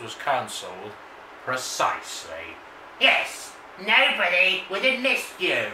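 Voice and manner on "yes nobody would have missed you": sneering creepy voice, higher. Acted. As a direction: taunt in a creepy voice. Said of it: "The creepy voice is better than you".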